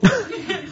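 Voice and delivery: short high giggle; high laugh. Real, not acted. Laugh at the anecdote.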